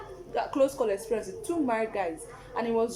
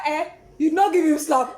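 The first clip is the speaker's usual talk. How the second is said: loud friend; 3 semitones higher, 8 dB louder than usual. Retold or imitated. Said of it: "She said".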